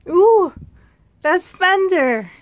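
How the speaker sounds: sudden high, loud ooh, then slow; surprised. Real, not acted. To viewers, hearing thunder outside: loud, high, surprised. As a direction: caught off guard by a thunderclap.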